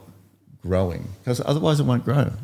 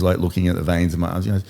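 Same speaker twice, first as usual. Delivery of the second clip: normal talking voice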